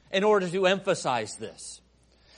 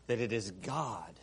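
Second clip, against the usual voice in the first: much softer and lower, fading; hushed emphasis; 9 semitones lower, 14 dB quieter than usual. Acted. Preacher drives home who roars, falling to a hush. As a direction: drop to a near hush for weight. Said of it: "hushed closing emphasis".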